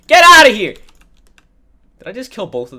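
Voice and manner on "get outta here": high, loud chase-off yell; shouted get out. Real, not acted. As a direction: yell to drive them off.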